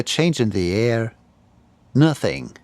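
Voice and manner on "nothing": plain reading voice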